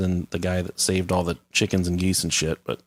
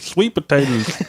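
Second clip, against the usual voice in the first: slow, high dopey drawl; dimwitted yokel answer; 5 semitones higher, slower than usual. Pretend. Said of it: "he sounded like an Earl".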